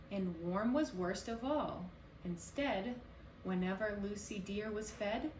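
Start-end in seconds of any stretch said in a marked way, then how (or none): none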